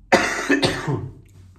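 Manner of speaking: lauter echter Hustenanfall; kräftig husten, noch erkältet. Real, not acted. Sick vlogger coughing. (erkältete Vloggerin hustet zwischen zwei Sätzen)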